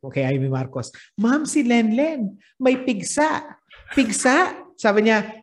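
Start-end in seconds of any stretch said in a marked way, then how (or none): 1.2-4.6 high, drawn-out mock-reporting voice; playful parody voice, rising into a question. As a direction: act out the comic tattletale, pitched up. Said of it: "may bading eh. Sabi niya".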